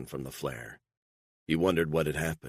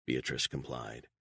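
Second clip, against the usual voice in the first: ordinary delivery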